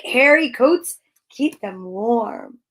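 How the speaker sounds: ordinary talk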